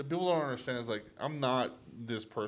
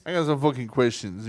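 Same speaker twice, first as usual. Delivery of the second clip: louder mock New York tough guy; New York accent; 8 dB louder than usual. Mobster repeating his demand for answers, louder New York accent. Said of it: "trying to do New York accent".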